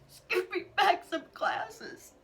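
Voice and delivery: slow, high, tearful begging; crying plea. Real, not acted. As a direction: plead through real tears. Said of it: "I said".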